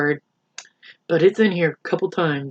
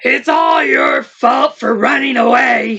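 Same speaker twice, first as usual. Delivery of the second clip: grumbling lion; 4 semitones higher than usual. Acted. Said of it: "he grumbled".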